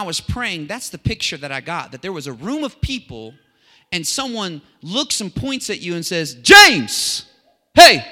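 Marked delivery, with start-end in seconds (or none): 6.3-8.0 sudden, very loud high shout; calling out. Acted. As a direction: shout to single someone out in a crowded room. Someone points and calls at you.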